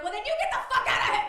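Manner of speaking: high, loud furious outburst; snapped yell. Retold or imitated. Producer snapping at a litigant who won't leave the bathroom, yelling. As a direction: lose it and yell her out. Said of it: "Like, I snapped".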